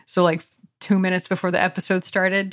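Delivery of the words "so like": ordinary delivery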